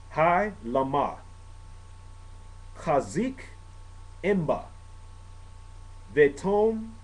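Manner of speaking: slow Hebrew liturgy, long pauses between words; careful liturgical Hebrew reading; reading Hebrew prayer. Shown as a demonstration. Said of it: "I'm gonna read this in Hebrew".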